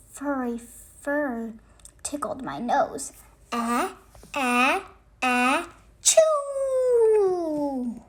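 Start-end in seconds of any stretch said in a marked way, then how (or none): 3.5-8.0 building ah-ah-choo, ending high and loud; a big theatrical sneeze; hammed-up sneeze. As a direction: wind up and blast the sneeze. Character sneezes.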